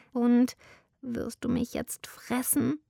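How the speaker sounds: höher, ängstlich gehaucht geflüstert; frightened squeak. Acted. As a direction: piepse es wie eine verängstigte kleine Maus. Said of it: "piepste Maximilian mit schwacher, ängstlicher Stimme". (ängstliches, höheres Flüstern der Maus)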